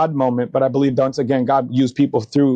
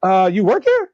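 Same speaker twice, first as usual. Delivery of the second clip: much higher, mock-surprised friend; surprised greeting; 8 semitones higher than usual. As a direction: imitate the old friend spotting him at valet. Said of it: "And he's like".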